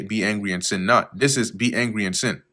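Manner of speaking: ordinary talk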